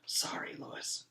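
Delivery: soft, whispered apology; hushed sorry. A narrator's reading. Girl whispering to the yard teacher at her desk. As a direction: whisper it so the teacher won't hear. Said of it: "she said".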